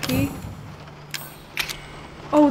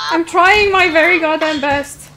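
loud, higher, drawn-out exasperation; exasperated protest; 4 semitones higher, 7 dB louder than usual. Real, not acted. Player protests to the game mid-struggle, exasperated. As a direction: protest loudly and slowly under pressure.

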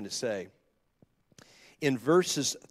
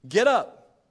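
commanding call; 7 semitones higher, 7 dB louder than usual. Acted. God's voice in the vision.

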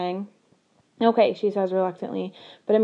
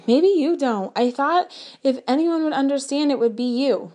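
louder, higher hurt comeback; raised hurt retort; 6 semitones higher, 4 dB louder than usual. A narrator's reading. The hurt girl shouts back at her best friend, louder and higher. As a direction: throw the words back at her, stung and raised. Narrator fires back in the fight.

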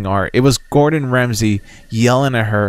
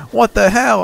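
startled outrage; 10 semitones higher, 4 dB louder than usual. Retold or imitated. Voicing startled old man.

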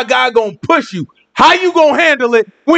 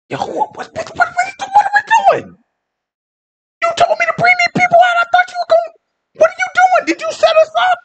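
squealing, high-pitched comic whine; stammering, hammed-up panicked complaint; panicked crying; faster than usual. People ready to break, wailing at Moses in mock panic. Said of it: "Man, stop crying".

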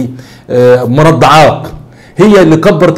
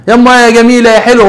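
higher, louder teasing compliment to his mother; joking flattery; 7 semitones higher, 3 dB louder than usual. Retold or imitated. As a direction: sing out a playful tease. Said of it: "عملها بهزار".

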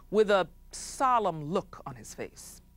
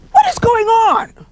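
startled chief's shout; 15 dB louder than usual. Acted. Chief bitten by mosquitoes.

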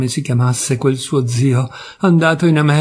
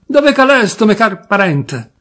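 put-on higher, louder Turin-accent bellow; the drunk demanding again, louder, in Turin dialect; louder Turin accent shout; 6 semitones higher, 4 dB louder than usual. Drunk cousin repeating his call, louder, Turin accent. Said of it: "con stretto accento torinese".

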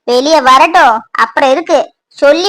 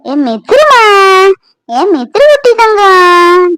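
put-on cooing at child; 4 semitones higher, much slower than usual. Grandmother coos at granddaughter.

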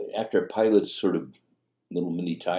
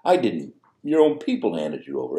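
Pilate's startled retort; 4 semitones higher, 5 dB louder than usual. Retold or imitated. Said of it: "Pilate reacts with".

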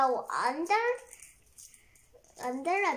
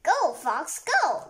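cheering; 3 semitones higher, 8 dB louder than usual. Real, not acted. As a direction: cheer the fox on. Child cheering story fox.

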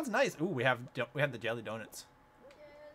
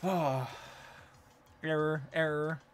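robot voice; 6 dB quieter, slower than usual. Pretend. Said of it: "Beep boop meow".

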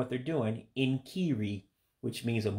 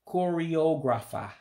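choreographer with an Igbo accent, slow and higher; 5 semitones higher than usual. Shown as a demonstration. Teacher to learners, Igbo-accented choreographer. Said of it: "the English word with an accent to it".

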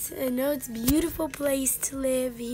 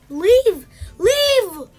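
shouting leave over and over, furious; angry yelling; 9 semitones higher, 9 dB louder than usual. Acted. Gamer yelling at a character to leave, furious shout. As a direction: yell at him to get out, louder each time.

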